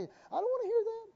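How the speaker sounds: high, quick mock complainer; mock refusal. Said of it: "people hate to hear this sometimes".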